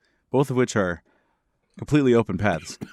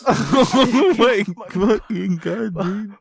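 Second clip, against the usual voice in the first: slow, high, laughing out the words; cracking up at the story; laughing disbelief; 11 semitones higher, 5 dB louder, much slower than usual. Real, not acted.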